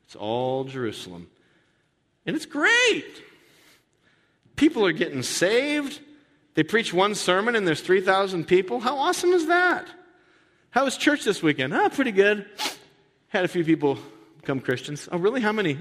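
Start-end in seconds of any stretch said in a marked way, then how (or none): 2.2-9.9 high, gushing, slowed excitement. Acted. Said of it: "mock-excited description of church". 10.7-11.7 chatty churchgoer. Acted. Acted chat between believers. 11.7-15.1 casual understatement. Acted. Acted casual reply. 15.1-15.8 surprised friend. Acted. Acted friend asking.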